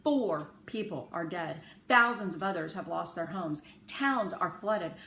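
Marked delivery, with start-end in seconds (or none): none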